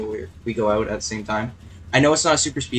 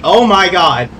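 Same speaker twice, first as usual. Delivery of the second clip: shocked; 7 semitones higher, 10 dB louder, slower than usual. Real, not acted. Near wreck in race.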